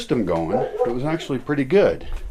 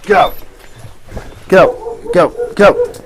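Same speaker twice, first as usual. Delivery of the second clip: loud, high yell at the dog; shouting at dog; 7 semitones higher, 8 dB louder than usual. Real, not acted. Owner yelling at the dog to get out, loud. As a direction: blast it at the dog.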